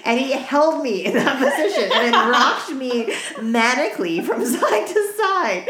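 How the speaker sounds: laughing through the words, high; giggling it out; story told through laughter; laughing while telling. Real, not acted.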